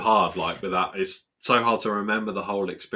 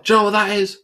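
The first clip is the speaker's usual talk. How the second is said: higher and louder, challenging; aggressive seller; 12 semitones higher, 12 dB louder, faster than usual. Retold or imitated. Seller showing off a rusty bike, loud challenge. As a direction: push the question at him like a bully. Seller voiced in a retold row.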